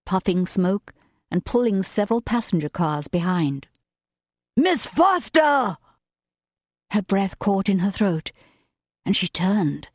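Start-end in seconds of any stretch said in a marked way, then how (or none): 4.6-5.8 high, drawn-out call across a station platform. Acted. William calls to her across the platform, loud and slow. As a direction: shout her name from a distance, slowly.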